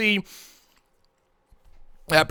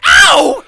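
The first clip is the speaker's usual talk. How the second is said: loud, high-pitched yelp of pain; pained scream; 15 dB louder than usual. A narrator's reading. Professor hit by a crash behind the door, loud high scream. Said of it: "you hear a feminine voice screaming".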